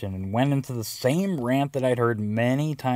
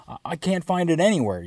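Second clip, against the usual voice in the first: whiny, a bit higher complaint; complaining; 6 semitones higher than usual. Retold or imitated. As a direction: grumble like a frustrated man. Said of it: "he complained".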